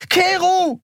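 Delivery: very high, protesting scream; high-pitched furious cry; screamed name. A narrator's reading. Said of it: "schrie sie".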